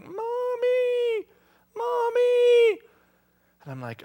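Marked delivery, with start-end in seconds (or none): 0.0-2.8 put-on much higher small-child voice calling; little daughter calling. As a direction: call out like a sick toddler at night. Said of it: "I hear Hope going".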